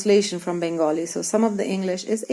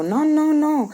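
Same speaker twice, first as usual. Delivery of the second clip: higher, playful telling-off; mock scolding; 8 semitones higher than usual. Brother scolding his baby sister, mock stern. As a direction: wag a finger in mock anger. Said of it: "I shake my head and go".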